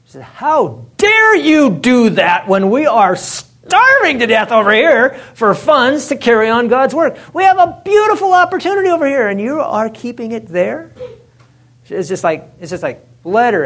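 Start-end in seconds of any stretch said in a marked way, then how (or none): 0.2-10.9 loud, higher, slowing then rushing into a shout; mock-livid prophet scolding the rich church. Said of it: "she just sounds livid".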